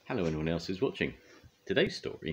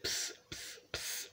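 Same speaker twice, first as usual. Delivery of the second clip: three voiceless psst sounds in a row; repeated psst; 12 dB quieter, slower than usual. A narrator's reading. The baby fussing for Mog: repeated voiceless psst. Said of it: "It said".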